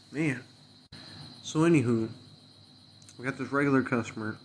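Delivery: normal talking voice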